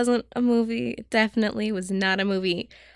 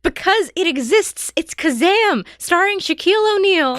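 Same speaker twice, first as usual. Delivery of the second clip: emphatic; 10 semitones higher, 6 dB louder than usual. Real, not acted. Emphatic correction of friend.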